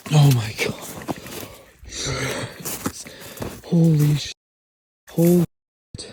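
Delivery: real thrilled outburst; excited. Real, not acted. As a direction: blurt it out in awe. Hunter reaches the huge buck.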